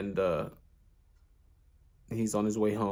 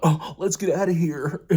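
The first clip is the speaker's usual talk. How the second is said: higher, louder fleeing punk with a laugh; panicked bully, laughing; 7 semitones higher, 7 dB louder than usual. Retold or imitated. The bullies run off, higher and louder with a laugh. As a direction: bail out and laugh at yourself.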